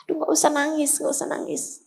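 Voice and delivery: soft soothing hush, fading to a whisper. Acted. Prophet hushing the crying girl, soft and soothing.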